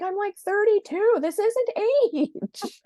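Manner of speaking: high, loud incredulous squeal; hammed-up outrage at the doctor; mock indignant disbelief. Retelling her reply to the doctor, high indignant squeal.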